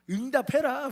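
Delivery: loud, high demand to God; protest shout. Retold or imitated. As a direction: bark it like a protest chant. Worship like a protest.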